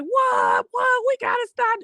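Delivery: high shouted what, what; high mock outrage, half yelled; shocked disbelief. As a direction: squeal the shock of the players. Players shocked at Max's claim.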